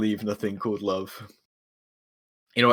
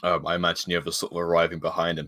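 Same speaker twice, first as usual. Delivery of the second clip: ordinary talk, nothing marked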